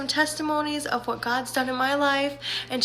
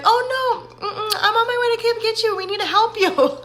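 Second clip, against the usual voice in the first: eager office manager; 11 semitones higher, 5 dB louder than usual. Retold or imitated. Office manager voiced insisting on help.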